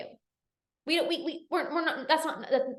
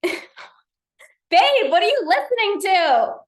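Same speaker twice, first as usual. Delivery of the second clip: loud, higher call to someone downstairs; playful call to husband, higher and louder; 6 semitones higher, 8 dB louder than usual. Real, not acted. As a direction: shout down the stairs to your husband.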